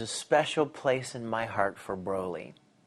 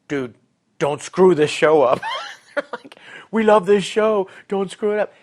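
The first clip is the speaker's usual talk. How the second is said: mock-urgent fan outburst, much louder and higher; raised-voice fan plea, half laughing; pleading fans; 9 semitones higher, 12 dB louder than usual. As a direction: imitate fans loudly warning you off. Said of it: "they were saying".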